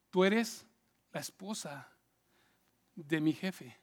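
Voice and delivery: low, soft, halting objection; quiet refusal. Acted. José turns down his boss's wife, low quiet refusal. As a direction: refuse gently with pauses between phrases. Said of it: "la Biblia dice que él dijo".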